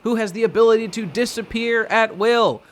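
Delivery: high, loud mock-heroic proclamation; grand hero introduction. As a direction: announce the superhero with full hype. Grand announcement of hero and power.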